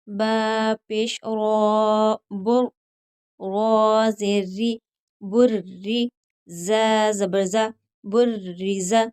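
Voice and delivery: slow, drawn-out letter-by-letter recitation; slow tajweed drill. Shown as a demonstration. Teacher drilling students letter by letter, slow recitation. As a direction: spell and join each syllable like a Quran teacher.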